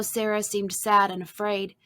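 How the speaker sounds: usual speaking voice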